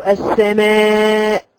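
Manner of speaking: teacher showing the mistake in السماء; wrong hamza release. Shown as a demonstration. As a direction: exaggerate the erroneous hamza bounce in السماء. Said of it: "تعمل كده".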